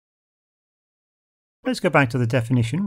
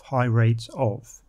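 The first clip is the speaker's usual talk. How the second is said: slow demo phrase; much slower than usual. Shown as a demonstration. Teacher models the phrase slowly to the class. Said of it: "It's usually followed by of".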